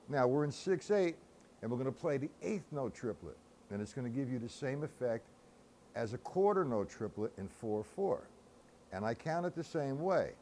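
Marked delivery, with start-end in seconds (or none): none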